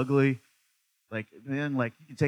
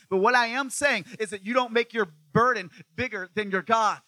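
preaching hard, building louder; fired-up preaching; 6 semitones higher, 5 dB louder than usual. Acted. Pastor preaching to the church at full force.

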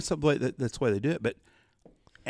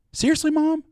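very high, loud disbelieving question; incredulous; 13 semitones higher, 6 dB louder than usual. Retold or imitated. Son challenging his mom over the scam story, loudly incredulous. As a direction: pile on the disbelief, voice shooting up.

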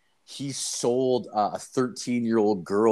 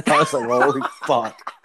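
laughing; 5 semitones higher, 5 dB louder than usual. Real, not acted. Laughing at the scary memory.

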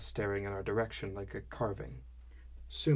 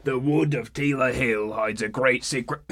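put-on half-drunk storyteller; 2 semitones higher, 10 dB louder than usual. A narrator's reading. Skivrin telling the newcomer his legend, half-drunk and dramatic. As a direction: ham up the ominous tale. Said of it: "tone of a half-drunk, novice storyteller".

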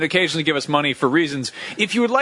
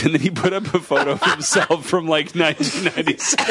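usual speaking voice

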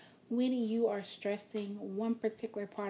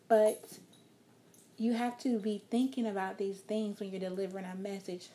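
ordinary talk, nothing marked

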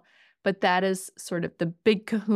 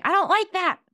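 much higher, louder bratty snap; snapping kid; 11 semitones higher, 6 dB louder than usual. Retold or imitated. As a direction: imitate an upset child snapping back at mom. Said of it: "snap at me".

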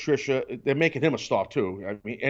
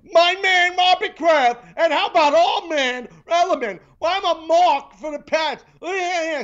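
loud, very high gushing kid imitation; mock little kid; 14 semitones higher, 7 dB louder than usual. Host mocking [name]'s fandom, loud high little-kid voice. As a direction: squeal it like an overexcited child fan. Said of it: "like a little kid".

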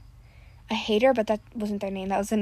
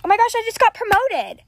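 higher and louder, thrilled; excited outburst; 10 semitones higher, 8 dB louder than usual. Real, not acted. Gamer girl shouts about her Bloxburg promotion, higher and louder. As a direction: burst out like you just won.

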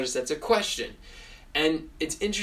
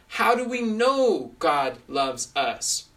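slow, deliberate teaching question; leading question; 4 dB louder, slower than usual. Shown as a demonstration. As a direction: ask it slowly to hook the listener. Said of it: "you can preface it with a question".

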